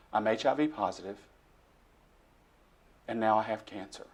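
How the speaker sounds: soft, low, broken plea to God; desperate plea. Retold or imitated. Alone in the dark room, pleading quietly with God. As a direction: quietly pour out despair. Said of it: "I sat down and I said these words".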